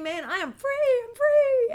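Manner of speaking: high, loud joyful whoop of a calf set free; gleeful freedom cry. Retold or imitated. Preacher voicing the calves bursting out of the stall, gleeful and loud. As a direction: voice the leaping calf crying out it is free.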